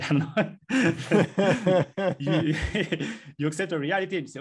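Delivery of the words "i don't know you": laughing through I don't know. Real, not acted. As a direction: chuckle it away. Laughs at own aside.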